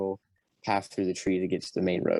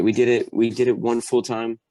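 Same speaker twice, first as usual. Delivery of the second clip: usual speaking voice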